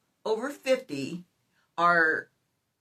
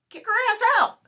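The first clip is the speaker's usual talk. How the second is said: mock yell quote; 13 semitones higher, 7 dB louder than usual. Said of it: "He says".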